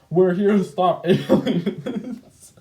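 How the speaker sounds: cracking up mid-line, slowed; breaking into a laugh on aliens. Real, not acted. Laughing while voicing character.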